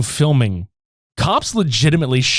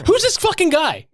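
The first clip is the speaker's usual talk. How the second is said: high-pitched indignant demand; mock offended. Brother confronting his sister's boyfriend, mock offended. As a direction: play an affronted brother, pitched way up. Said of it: "this man is so offended".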